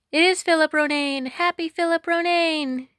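put-on high, joyful children's cry; children cheering. Children cheer the right choice.

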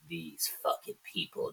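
near-whispered, slow grumble; muttered grumble. Retold or imitated. Boss looking around the room, muttering to himself in disbelief. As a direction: grumble it slowly under your breath.

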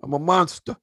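hammed-up big guy complaining; mock outrage. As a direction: rant about your size for laughs. Imagined self-deprecating rant.

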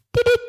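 high squeaky Siri beep; mimicked phone beep; imitated beep. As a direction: chirp it like a phone chime.

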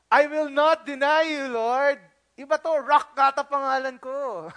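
loud impulsive bragging Peter; boastful Peter. Acted. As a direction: brag like nothing can shake you. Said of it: "because of his impulsiveness".

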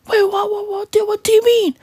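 high, flustered mock British voice; high-pitched confused stammer. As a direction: play a bewildered British pop star. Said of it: "[name] would be like".

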